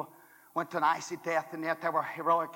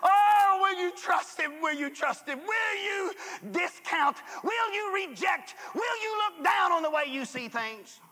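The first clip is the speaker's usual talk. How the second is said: full-force preaching; 12 semitones higher, slower than usual. Acted. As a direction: preach it like thunder from the pulpit. Preacher builds to shouting.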